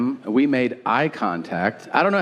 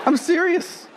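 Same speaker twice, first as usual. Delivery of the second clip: emphatic insistence. Real, not acted. Insists on his rant.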